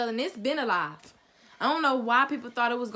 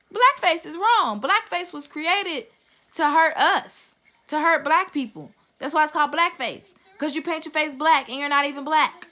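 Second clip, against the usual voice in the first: loud, raised, emphatic indignation; angry condemnation; 4 semitones higher, 6 dB louder than usual. Real, not acted. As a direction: hammer each point home, loud and high.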